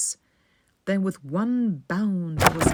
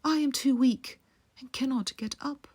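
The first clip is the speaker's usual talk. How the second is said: frail grandmother; 7 semitones higher than usual. A narrator's reading. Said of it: "grandmother explains weakness".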